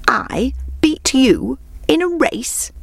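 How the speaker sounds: high, slow, drawn-out disbelief; incredulous exclamation. A narrator's reading. Hare answering the tortoise's challenge, incredulous exclamation. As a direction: draw it out high, as if the idea is absurd. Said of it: "exclaimed the hare".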